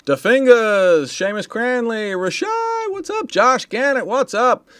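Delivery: high, loud name-by-name greetings; hyped shout-outs. Real, not acted. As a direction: call out to friends in the chat. Calling out names from the chat.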